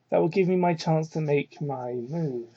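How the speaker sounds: ordinary talk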